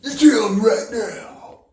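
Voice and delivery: very high, loud, slow screamed order; acted furious scream. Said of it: "More feeling?".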